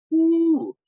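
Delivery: high, loud "woo" of relief; excited woo. Retold or imitated. Fan letting it out after the star left, high whoop. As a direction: let out a pent-up whoop.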